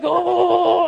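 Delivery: high, drawn-out "oh"; hammed-up high moan; mock straining groan. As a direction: groan as if head is squeezed. Acting squeezed head.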